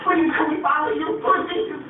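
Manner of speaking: high-pitched, pleading imitation; mock begging. Preacher imitating outsiders begging to join, high mock plea. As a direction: plead like a desperate follower, hammed-up. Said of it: "They will come begging".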